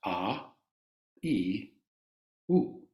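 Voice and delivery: a, i, u said slowly and separately; Sanskrit vowels. Shown as a demonstration. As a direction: spell out the Sanskrit vowels one by one. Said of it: "first three ... of the Sanskrit alphabet".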